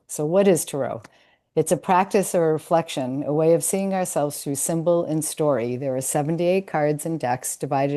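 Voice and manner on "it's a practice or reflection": usual speaking voice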